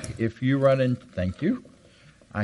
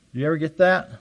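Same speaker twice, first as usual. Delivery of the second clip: ordinary talk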